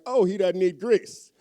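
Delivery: loud, slow mocking sneer; sarcastic. People mocking his diet, loud and sarcastic. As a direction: drip with sarcasm. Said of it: "Get all sarcastic with me".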